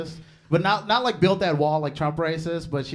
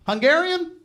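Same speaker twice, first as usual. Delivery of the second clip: mock outrage; 12 semitones higher, 7 dB louder than usual. Punchline about the Hungarian language.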